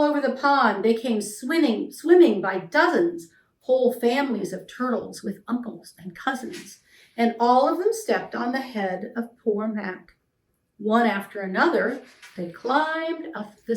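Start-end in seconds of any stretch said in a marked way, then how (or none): none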